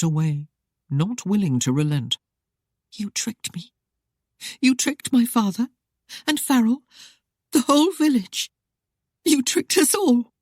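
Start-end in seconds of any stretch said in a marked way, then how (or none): 2.9-10.3 rising from hushed to high tearful outburst; betrayed wife accusing him in tears; sobbing accusation. Acted. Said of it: "she sobbed".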